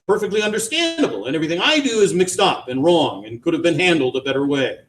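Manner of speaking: high, sarcastic complaint; bitter grievance. A narrator's reading. Wife complaining to husband, bitterly sarcastic. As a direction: list his faults with scorn.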